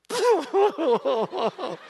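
a high, wailing sob-laugh; a sudden high wail of tears; mock sobbing burst. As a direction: break into a hammed-up cry. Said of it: "and I go".

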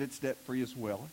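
put-on gently, like a comfortable old lady; lightly voiced widow. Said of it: "She said".

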